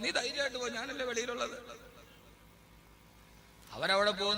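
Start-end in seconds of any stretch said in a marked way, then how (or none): 0.0-1.6 lightly acted husband in higher, softer voice; reassuring husband. Husband to wife at labour room door, mock reassuring. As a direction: send her off with gentle mock reassurance. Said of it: "അപ്പൊ അവൻ പറയും".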